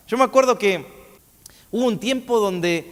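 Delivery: ordinary delivery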